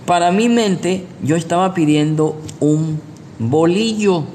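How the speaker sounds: normal talking voice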